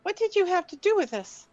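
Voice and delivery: high-pitched hobbit questioning; little high hobbit voice. Acted. Lily questions the warrior over the boy, little high hobbit voice. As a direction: question a stranger in a small, high voice. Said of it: "in her little high hobbit voice".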